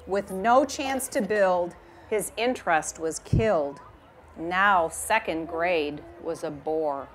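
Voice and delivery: reading a rhyming picture book aloud; gentle storytime reading voice; story narration. A narrator's reading. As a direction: read it like storytime for a class.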